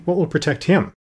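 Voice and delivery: high, worried question; anxious. A narrator's reading. Girl asks Tin Woodman about Toto, anxious. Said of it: "said the girl anxiously".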